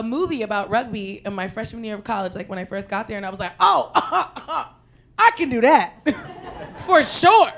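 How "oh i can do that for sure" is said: cocky self. Retold or imitated. Cocky college freshman self.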